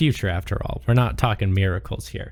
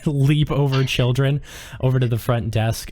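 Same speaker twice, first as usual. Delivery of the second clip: ordinary talk, nothing marked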